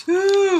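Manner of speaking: one long, high hoot; owl hoot. Acted. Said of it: "and the owl goes".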